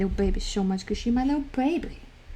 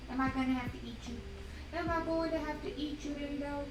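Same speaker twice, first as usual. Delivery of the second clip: higher, playful cooing threat; 5 semitones higher, 11 dB quieter, faster than usual. Real, not acted. Mother playfully threatening her little dog in baby talk. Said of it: "I'm in baby talk mode".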